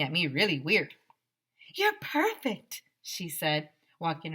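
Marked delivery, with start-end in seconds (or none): 1.7-2.8 high, slow, swooning praise; admiring diva. A narrator's reading. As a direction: swoon over the boy. Miss Joni admiring.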